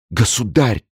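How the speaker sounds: despairing cry. A narrator's reading. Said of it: "воскликнула в полном отчаянии лавальер".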